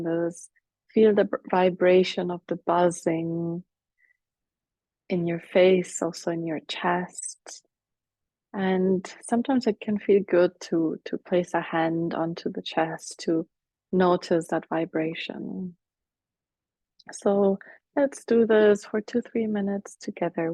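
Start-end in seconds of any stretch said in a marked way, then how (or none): none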